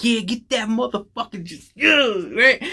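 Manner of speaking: high-pitched egging-on from the crowd; excited cheering. Retold or imitated. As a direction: cheer her on in a high, strained voice. Acts out audience cheering the hero on.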